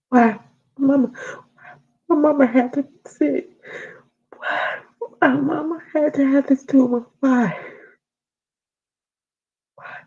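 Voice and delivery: high, broken repeated why; pleading over mom's death, voice cracking high; grieving cry. Retold or imitated. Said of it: "I felt like".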